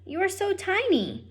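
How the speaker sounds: high, sweet princess voice; a young princess cooing at a tiny mouse, high and sweet. Acted. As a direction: coo it like a fond princess.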